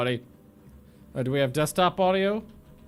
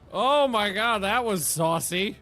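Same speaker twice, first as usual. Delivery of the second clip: ordinary talk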